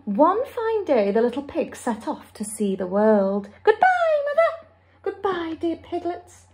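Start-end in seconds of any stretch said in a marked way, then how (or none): 3.6-4.7 high, bright-pitched piglet farewell; piglets calling goodbye. Acted. Piglets setting off to see the world, calling goodbye. 5.0-6.4 lightly voiced mother, higher and softer; a soft, raised-pitch motherly goodbye; mother pig's farewell. Mother sees her piglets off, soft farewell.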